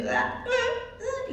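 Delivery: long high startled ah sounds, slowed; slow, high, surprised ahs; drawn-out high gasp. Retold or imitated. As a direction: stretch out shocked high gasps. Retold startled moment at sink.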